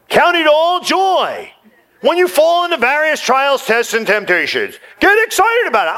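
quoting Jesus. Retold or imitated. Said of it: "And Jesus is crazy".

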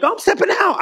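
high, eager announcement of stepping out; mock bold declaration. Believer stepping out in faith, high eager mock announcement. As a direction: pitch it up like a proud leap of faith. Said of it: "you know, like".

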